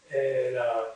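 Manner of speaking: normal talking voice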